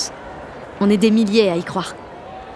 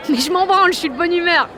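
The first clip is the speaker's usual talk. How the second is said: high, carefree exclamation; giddy elation; 9 semitones higher, 3 dB louder than usual. Acted. Jeanne brushes off being naive, giddy high spirits. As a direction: burst out gleefully, pitch way up. Giddy good mood at the rally.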